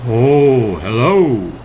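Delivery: mildly put-on moose voice, not far from the narrator; lightly voiced moose. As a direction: greet him like a lumbering friendly moose. Moose greets cricket in story.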